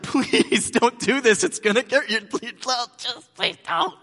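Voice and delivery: slow, high desperate begging; hammed-up parental pleading, rising to a squeak. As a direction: beg the kid not to touch it. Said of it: "you have to move to pleading".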